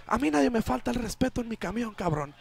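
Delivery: high, fast furious driver yelling; angry yell in Spanish. Retold or imitated. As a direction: snap at the robber in Spanish. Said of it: "suddenly yells".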